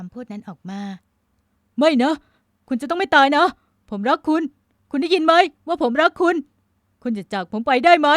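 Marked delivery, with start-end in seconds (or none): 1.3-8.1 loud, high, anguished pleading; desperate lament. A narrator's reading. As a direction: beg a dying lover not to go. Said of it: "ชายหนุ่มพร่ำรำพัน".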